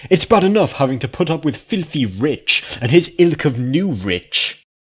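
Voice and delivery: high, loud haughty noble lady; snobbish complaint. Acted. Mare complaining about lesser ponies, high and haughty. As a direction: look down your nose at them.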